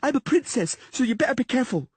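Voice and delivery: put-on high, loud imperious child; girl threatening the Witcher, high and haughty; haughty princess. A narrator's reading. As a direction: threaten like a spoiled royal.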